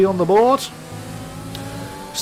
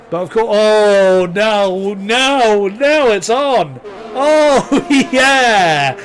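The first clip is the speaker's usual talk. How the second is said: louder, drawn-out thrill; excited build-up; 2 semitones higher, 4 dB louder, much slower than usual. Real, not acted. Race restarts, bunched field.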